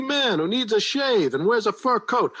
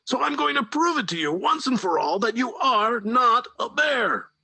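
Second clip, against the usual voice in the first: higher, firm mock anger; stern president; 10 semitones higher than usual. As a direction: settle it once and for all. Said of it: "The president said".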